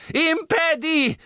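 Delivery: high, slow, loud spell; shouted spell. Acted. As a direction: cast it with a shout. Harry casts a spell in battle. (gerufener Zauberspruch)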